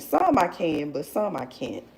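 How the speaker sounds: usual speaking voice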